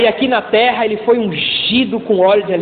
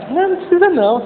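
put-on higher, modest girl declining; lightly voiced shy girl, pitched up; girl politely refusing the sundae, higher; girlfriend's voice; 8 semitones higher, faster than usual. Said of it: "Aí ela".

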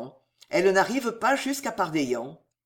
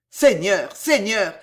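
inner growl; 6 dB louder, slower than usual. A narrator's reading. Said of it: "gronda le chevalier tout au fond de lui-même".

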